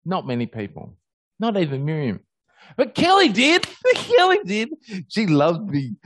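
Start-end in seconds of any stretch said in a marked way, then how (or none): 2.8-5.9 much higher, louder and laughing on "Kelly did"; delighted squeal. Real, not acted. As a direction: gush with joy about the lamb. Fond memory of the lamb.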